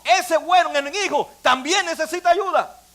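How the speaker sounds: ordinary talk, nothing marked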